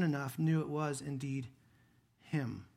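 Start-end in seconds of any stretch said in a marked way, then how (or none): none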